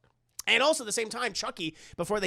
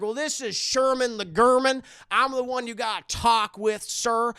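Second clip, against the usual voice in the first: raised, loud mock-official voice; self-important team leader; 4 semitones higher, 7 dB louder than usual. Sherman answering Chucky's radio call, self-important team leader. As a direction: announce yourself as the one in charge.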